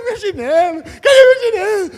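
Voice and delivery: put-on high, loud childish whine; whining child. Preacher plays himself as a boy whining for his slipper. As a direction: whimper like a little boy hunting his slipper.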